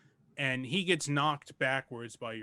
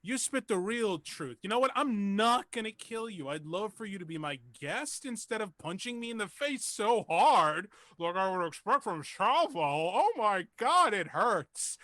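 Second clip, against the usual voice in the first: higher, excited starstruck voice; gushing fan; 8 semitones higher, 4 dB louder than usual. Acted. Cult leader gushing to the wrestler who punched him, high and giddy. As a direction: fawn over him like a superfan. Npc fanboying over a wrestler.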